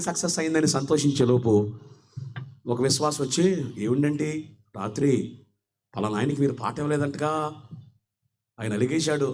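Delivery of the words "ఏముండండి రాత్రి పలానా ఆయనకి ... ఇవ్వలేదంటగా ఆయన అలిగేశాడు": gossiping believer. Retold or imitated.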